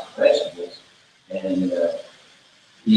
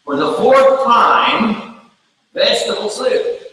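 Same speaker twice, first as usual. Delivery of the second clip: loud, high, drawn-out exasperation; exasperated wife; 12 semitones higher, 3 dB louder, slower than usual. Acted. Wife answering husband for the fourth time, exasperated. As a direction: spell it out slowly at high pitch, fed up.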